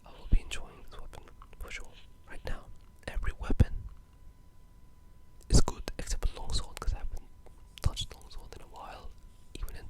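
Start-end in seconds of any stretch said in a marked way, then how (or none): none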